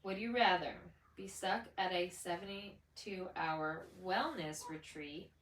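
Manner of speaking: ordinary talk, nothing marked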